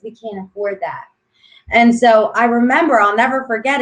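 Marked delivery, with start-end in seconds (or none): 0.0-1.0 softer, hushed aside to her husband; quiet mom. Retold or imitated. Mom telling her husband they can't afford it, hushed. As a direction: murmur it so the child won't hear. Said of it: "she turns to her husband and she says".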